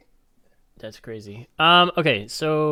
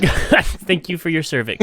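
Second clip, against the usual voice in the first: laughing; 6 semitones higher, 7 dB louder, slower than usual. Real, not acted. Laughing through the joke.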